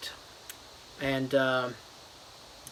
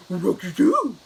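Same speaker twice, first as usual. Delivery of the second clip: garbled cartoon dog voice, higher and louder; Scooby-Doo; 4 semitones higher, 5 dB louder than usual. Left-behind boy calls after the kids, cartoon dog voice. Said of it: "he sounded like Scooby-Doo".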